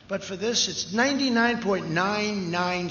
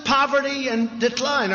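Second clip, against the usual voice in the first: ordinary talk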